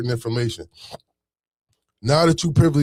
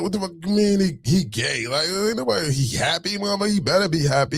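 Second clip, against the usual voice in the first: outraged retort; 6 semitones higher than usual. Retold or imitated. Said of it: "I'm like".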